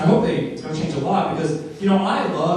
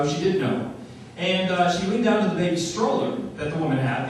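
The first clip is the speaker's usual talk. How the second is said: ordinary talk, nothing marked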